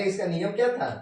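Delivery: ordinary talk, nothing marked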